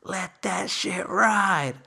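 imitating hyped friends; hyped-up egging on. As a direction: egg him on, stretching each word. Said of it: "they're all hyped up".

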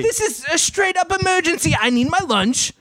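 angry but British. Acted. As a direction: insist it's an emergency, hammed-up. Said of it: "angry, but British".

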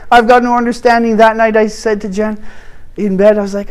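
usual speaking voice

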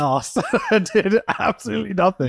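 laughing. Real, not acted. Laughing admission.

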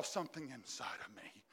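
hushed whisper. Acted. Preacher drops to a hush.

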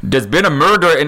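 put-on Scottish accent collapsing into giggles. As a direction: try a Scottish accent, cracking up. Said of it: "in a Scottish accent".

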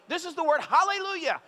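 high, loud, sing-song line; singsong teaching voice. Shown as a demonstration. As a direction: sing it out like a kids' show host. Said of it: "So say hallelujah".

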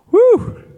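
very high, loud joyful woo; excited whoop. Real, not acted. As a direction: let out a thrilled whoop. Preacher thrilled by fire chariots.

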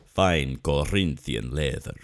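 luxurious Spanish commercial voice, dropping low; Spanish accent. Acted. Imitating a Spanish commercial actor for a co-host, Spanish accent. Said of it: "like, a Spanish guy who was like".

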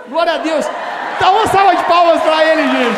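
muito mais alto, gritando louvor; exaltação em voz alta. Real, not acted. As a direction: chame a igreja para aplaudir em alta voz. Pastor calls congregation to applaud. (pastor empolga a igreja, bem mais alto)

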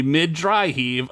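usual speaking voice

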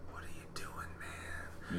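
retold inner remark in a soft whisper; whispered reaction. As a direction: whisper it, voiceless and hushed. Retold private reaction to odd guy.

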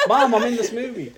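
high, loud, proud shout to Mom; excited. Pretend. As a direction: call out thrilled to Mom. Voicing actor calling his mom.

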